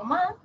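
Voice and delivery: "ma" as a lone syllable in one tone; tone demonstration. Shown as a demonstration. Teacher contrasts tones like Chinese, first tone demonstration. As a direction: say "ma" as a tone example, held level. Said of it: "cambio el tono".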